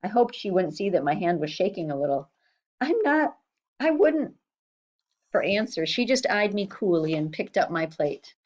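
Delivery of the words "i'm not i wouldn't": anxious protest. A narrator's reading. Boy protests accusation.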